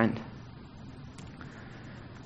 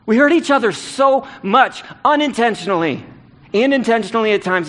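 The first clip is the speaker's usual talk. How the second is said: higher and louder, driving each phrase home; preaching at full force; 7 semitones higher, 3 dB louder than usual. Acted. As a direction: preach it like a rallying call to the church. Preaching climax on hurting each other.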